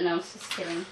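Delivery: ordinary talk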